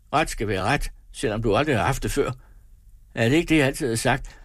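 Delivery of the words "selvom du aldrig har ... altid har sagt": usual speaking voice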